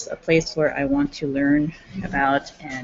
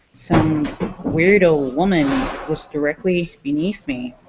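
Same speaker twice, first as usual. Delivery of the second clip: usual speaking voice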